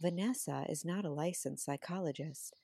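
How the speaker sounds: hushed, near-whisper ad voice; imitating a breathy podcast host; soft podcast voice. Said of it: "their voices were like this".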